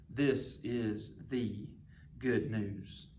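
slow and soft, leaning on "the" in "This is the good news"; stress on THE. Shown as a demonstration. Preacher showing Mark's emphasis, slow and soft, stressing the. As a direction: let "the" carry the weight, the one and only good news. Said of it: "he puts the emphasis on the word "the".

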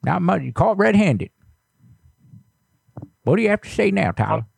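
put-on creaky old neighbor, fussy and nosy; old man voice. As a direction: play a suspicious grumpy grandpa. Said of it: "anytime I use that old voice".

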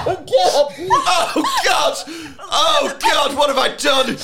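high, loud mock anguish; anguished cry. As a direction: wail it in hammed-up despair. Mock horror at own joke.

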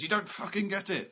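high-pitched mock outburst. Actor berating the apologising crew member, imitated angry outburst. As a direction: imitate an exasperated star cutting off an apology. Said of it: "he does really go on at this guy".

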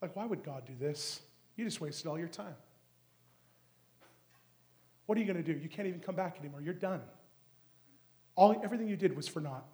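soft, taunting devil voice; devil's whisper. Retold or imitated. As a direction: murmur it like a tempter at your ear. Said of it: "Try to whisper in your ear".

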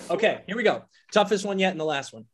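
ordinary delivery

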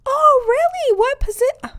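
very high, put-on fascination; mock eager interviewer. Interviewer hearing about candidate's brother, mock fascinated. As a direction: gush with exaggerated polite interest.

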